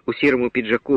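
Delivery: ordinary delivery, nothing marked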